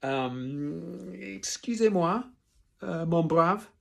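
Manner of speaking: high, hesitant, drawn-out French; absent-minded. A narrator's reading. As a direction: grope for the phrase, mind elsewhere. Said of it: "absent-mindedly".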